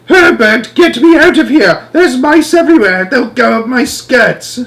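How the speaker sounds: high, loud shrieking woman, slowing at the end; shrill panicked wife. Acted. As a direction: shriek at your husband to save you from the mice. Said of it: "Missus Jenkins's shrill voice".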